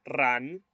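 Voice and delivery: "run" with a rolled Spanish R; wrong Mandarin "run", said with a tongue trill; Spanish R mistake. Shown as a demonstration. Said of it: "No es el sonido de la R española".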